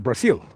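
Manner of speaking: "Brazil" said with an S sound, not a Z; Brazilian pronunciation. Shown as a demonstration. Streamer showing viewers how Brazilians say it, "Brazil" with an S. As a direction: say "Brazil" as Brah-SEEL. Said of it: "as the Brazilians call it".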